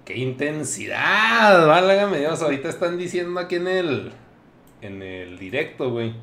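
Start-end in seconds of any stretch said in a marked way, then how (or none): 0.1-2.4 loud, slow exclamation; amazed. Real, not acted. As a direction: marvel at it out loud. Reacting to intense guest.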